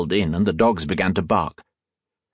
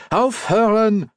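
very high, drawn-out German command; slow, exasperated high-pitched order; demanding, escalated; 13 semitones higher, 3 dB louder, slower than usual. A narrator's reading. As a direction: insist slowly that the dogs stop. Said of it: "he demanded".

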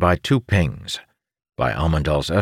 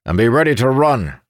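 loud, fast commanding shout; 8 semitones higher, 5 dB louder than usual. Acted. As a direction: bark orders to the squad. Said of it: "Moradin shouted".

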